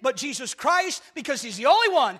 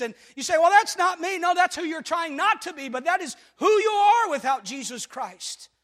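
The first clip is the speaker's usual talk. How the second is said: loud, climbing preaching voice, nearly shouting; full-force preaching; 5 semitones higher, 4 dB louder than usual. Acted. Pastor preaching to his congregation, building to a near shout. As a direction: preach it hard to the back row.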